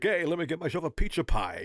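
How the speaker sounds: hammed-up New York voice ordering pizza; lightly voiced Sean Connery going Brooklyn; mock Brooklyn accent. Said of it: "kinda doing a Brooklyn thing".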